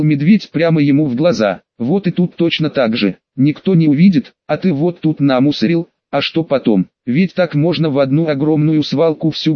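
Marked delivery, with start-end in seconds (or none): none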